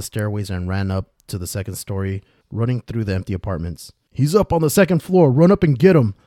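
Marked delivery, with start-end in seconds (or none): 4.1-6.1 high, loud urgent shouting; guard barking out a plan, high and loud; shouted orders. A narrator's reading. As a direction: shout orders to your partner in a chase. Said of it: "one of the guards shouted".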